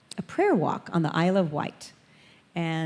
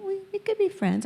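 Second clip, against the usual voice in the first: put-on hesitant child; 13 semitones higher than usual. Girls answering hesitantly.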